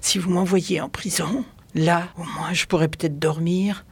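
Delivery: hushed, spent sigh easing into weary speech; exhausted, overwhelmed. A narrator's reading. Exhausted girl pleading with the inspector, drained. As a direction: start almost voiceless, utterly worn out. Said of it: "accablée par une lassitude qui n'était pas feinte".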